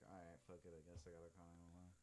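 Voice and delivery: resigned under breath. Retold or imitated. Resigned decision to call police.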